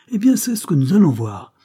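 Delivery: high, loud defiant threat; heated challenge. A narrator's reading. Over-excited poet about to grab the captain's wife, defiant. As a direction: fling it out as a challenge. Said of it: "en s'exaltant de plus en plus".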